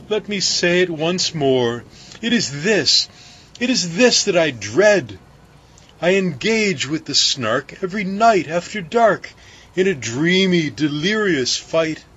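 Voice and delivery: mild fearful foreboding; baker's dread. A narrator's reading. Baker confessing his dread to crew, mildly anxious.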